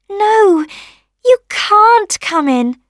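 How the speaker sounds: high, loud, drawn-out refusal; rosebud refusing. A narrator's reading. Rosebud keeping the rain out, high firm voice. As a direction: refuse slowly in a high little voice.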